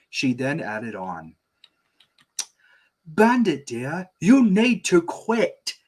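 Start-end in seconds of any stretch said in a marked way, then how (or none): 3.1-5.8 high, slow scolding mom. Acted. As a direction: scold her husband, drawn out. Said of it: "first take of Chili's line".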